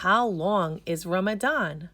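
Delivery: plain reading voice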